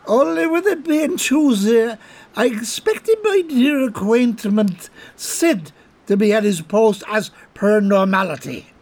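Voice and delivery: squirm out of it with a giggle; nervous chuckle. Acted. Colm backing off the rifle, high and nervously laughing.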